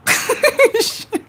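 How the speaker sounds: a high burst of real laughter at the chat. Real, not acted. Tarot reader cracking up at her viewers' comments, real laughter. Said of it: "You guys are fucking crack ups".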